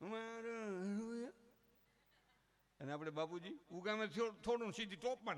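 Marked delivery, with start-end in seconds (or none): 0.1-1.3 drawn-out, higher mock-threatening mother; angry mother. Mother raising her hand at the child, slow drawn-out mock anger. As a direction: stretch it slowly as the hand goes up. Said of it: "આટલે હાથ હોય ને".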